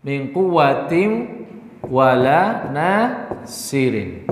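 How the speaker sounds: slow chanted tajwid recitation; Quran recitation. Shown as a demonstration. (bacaan tartil pelan)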